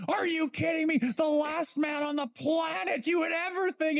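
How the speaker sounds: mock an announcer refusing to credit the goal; announcer stunned by an unlikely goal; imitating Jack Edwards. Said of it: "He's like".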